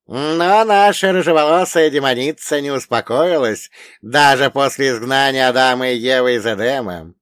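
high, loud elder quizzing a pupil; old storyteller pitched high and forceful; wise old dead man. Acted. Old man continues the legend.

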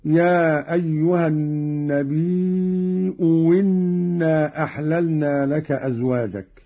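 "النبي إنا" as nabiii'u-winna, long ii; second hamza as waw. Shown as a demonstration. Teacher showing Qalun's reading, "إنا" turned to winna after "النبي". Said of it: "أو إبدالها واوا وصلا هكذا".

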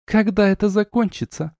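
high-pitched exasperated demand; lightly acted hiss. A narrator's reading. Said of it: "прошипела Арна".

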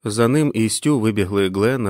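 plain reading voice

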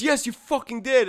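high, mock furious retort; imitated yelling parents. Parent overruling the crying kid, high mock yell. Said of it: "and they yell at him".

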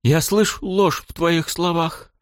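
sobbing out the words; crying. A narrator's reading. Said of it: "уже явно рыдая, кричала девушка".

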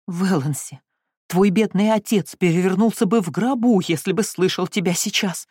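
loud, shocked outcry at her daughter; exclaiming. Acted. Mother exclaiming in shock at Valancy's words. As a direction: cry out, scandalized. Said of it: "воскликнула миссис Фредерик".

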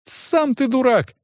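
put-on high-pitched girl's retort; girlish cry without much volume; indignant cry. A narrator's reading. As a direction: throw the insult back high and drawn out. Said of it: "крикнула девочка".

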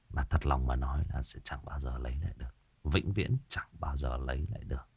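whispered, soft and a little quick; hushed whisper. Real, not acted. Host musing to listeners about lost things, whispering. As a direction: let the regret drop to a breath. Host reflecting on loss, sad.

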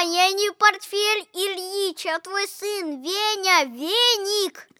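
normal talking voice

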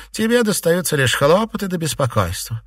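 snide. A narrator's reading. Mistress mocking the servant's troubles, snide. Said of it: "не без ехидства произнесла".

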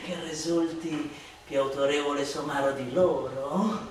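usual speaking voice

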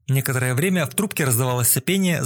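usual speaking voice

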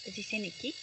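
ordinary delivery, nothing marked

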